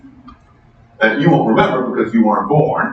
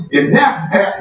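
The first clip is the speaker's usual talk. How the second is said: put-on mild Texas accent on a politician's warning; 7 semitones higher than usual. Lecturer imitating Perot's Texas accent in the debate. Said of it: "he did it in the Texas accent".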